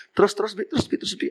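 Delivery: high, breathless pleading to continue; eager urging. Acted. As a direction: hurry him on, half whispering. Wife eagerly urges story on. (istri penasaran, mendesak setengah berbisik)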